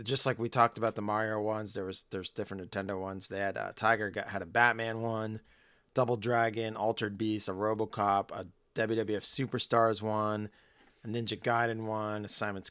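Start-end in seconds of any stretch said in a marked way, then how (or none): none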